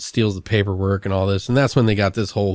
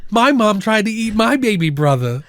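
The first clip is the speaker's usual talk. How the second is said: higher-pitched, hammed-up movie line; mock distress; 10 semitones higher than usual. Podcaster retelling a bad horror film's dialogue, mock distress. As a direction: put on a mock-upset character voice.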